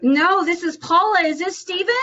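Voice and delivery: high, upbeat cold-call greeting; perky sales call hello; bright phone voice. Real, not acted. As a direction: sound friendly and eager on the phone.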